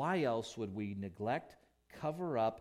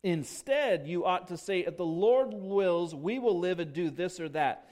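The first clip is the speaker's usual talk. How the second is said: high, loud, fervent preaching; preaching at full force; 6 semitones higher, 10 dB louder than usual. Acted. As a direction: swell into passionate preaching.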